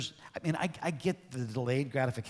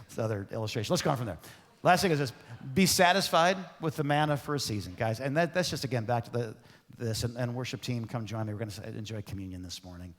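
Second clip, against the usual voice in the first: ordinary talk, nothing marked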